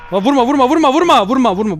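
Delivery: high, loud repeated plea; panicked pleading. Pretend. Player under attack by grandpa, panicked pleading. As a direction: cry out to stop the blows. Begs not to be hit.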